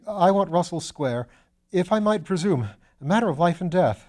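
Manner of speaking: frantic, out-of-breath gentleman begging for the cab; hurried gasps. A narrator's reading. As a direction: gasp it out as if you'd run far. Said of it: "He spoke in hurried gasps".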